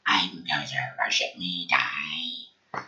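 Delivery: very low mock monster voice; imitating a menacing deity, far below normal pitch; Gozer. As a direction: drop deep for a hammed-up evil god. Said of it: "Gozer walking around all".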